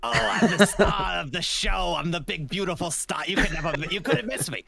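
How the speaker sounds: hammed-up conceited star in a put-on voice; Quilladin. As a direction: boast like a cartoon diva. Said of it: "Are we gonna see you, Quilladin?".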